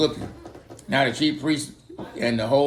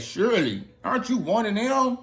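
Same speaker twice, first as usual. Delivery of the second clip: higher, prying accusing voice; nosy bystander pressing Peter, higher; 7 semitones higher than usual. Acted. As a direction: needle him like a busybody. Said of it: "they said".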